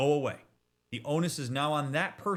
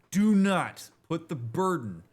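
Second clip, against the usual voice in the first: slow, louder and higher, a stern warning; pressing each word home; firm emphasis; 5 semitones higher, 6 dB louder, slower than usual. Real, not acted. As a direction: lay down the rule. Emphatic advice to viewers.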